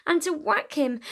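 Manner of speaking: ordinary delivery, nothing marked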